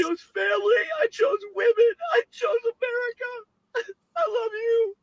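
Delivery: high, wailing sobbed words; sobbing. Real, not acted. A woman crying to her phone after the loss, sobbing. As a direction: cry it out between sobs.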